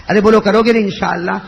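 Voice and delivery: loud, urging the crowd to answer; rousing call. Real, not acted. Preacher demands a yes from the congregation, loudly. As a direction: call out for their promise.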